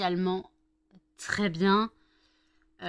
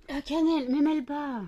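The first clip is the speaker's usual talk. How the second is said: calling out loud to the cat; yelling across the room; 8 semitones higher, 6 dB louder than usual. Real, not acted.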